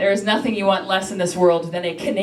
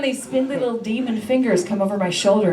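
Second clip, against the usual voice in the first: usual speaking voice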